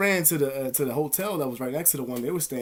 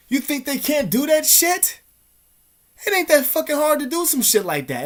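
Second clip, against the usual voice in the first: high, loud, incredulous exclamation; indignant outburst; 13 semitones higher, 7 dB louder than usual. Real, not acted. Man ranting about being controlled, indignant outburst. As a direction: raise the voice high in disbelief.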